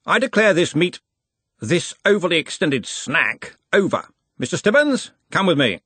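put-on a hearty old wizard in charge; Ridcully's voice.

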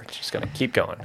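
whispered, sarcastic aside. Acted. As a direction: whisper it as a joke.

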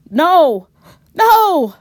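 high, loud scream of terror; terrified scream. Acted. Robin pinned under the shadow figure, screaming in terror. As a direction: shriek as it smothers you. Figure crawls on top of her.

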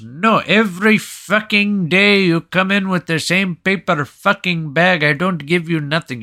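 fed-up counterman's mock Indian accent. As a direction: imitate an Indian shopkeeper refusing angrily. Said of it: "the Indian guy behind the counter".

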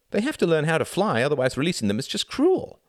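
put-on high, insistent child voice; child justifying. A narrator's reading. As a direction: argue it high and loud, like a child. Narrator voices child justifying himself.

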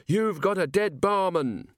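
high, drawn-out mock scream; screamed threat. A narrator's reading. Robber threatening the barman before the room, screamed. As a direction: stretch the threat out slowly up high. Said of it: "he screamed".

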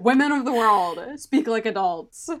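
loud playful rant; exasperated proclamation. Pretend. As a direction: proclaim it to all womankind, exasperated. Exasperated mock proclamation to women.

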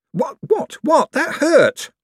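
very high, startled yelp of words; high, loud pained objection; indignant protest. Acted. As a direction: protest as if just jabbed. Clerk protests being nudged hard.

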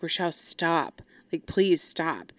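mildly pleading, softening and slowing down; quietly implore, drawing out the last plea; retold pleading. Mom begs her daughter to stop, retold pleading. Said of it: "she starts pleading with me".

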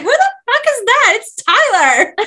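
high, loud outburst of disbelief; retold shriek of recognition; acted shock. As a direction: blurt it out in hammed-up astonishment. Acting heroine's shock at seeing Tyler.

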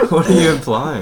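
loud, high-pitched through real laughter; laughing challenge. Real, not acted. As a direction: ask it while cracking up. Laughing at friend's risky remark.

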